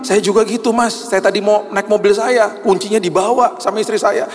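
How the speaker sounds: high, eager voice; a high, amazed passenger; excited retelling. Retold or imitated. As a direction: tell him the coincidence eagerly. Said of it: "saya juga bilang".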